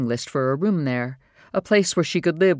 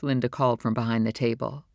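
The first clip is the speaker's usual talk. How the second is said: plain reading voice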